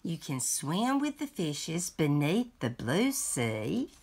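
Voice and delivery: lively picture-book read-aloud; reading to children. Acted. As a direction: read it like a bedtime story to a grandchild.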